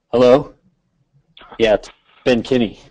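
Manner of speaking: lightly voiced self-important caller, pausing for effect; a hint of a smug friend announcing himself on the phone; mock Ben Kinney. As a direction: mimic a caller who expects to be recognized, with a big dramatic pause. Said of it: "I'll do my Ben impression".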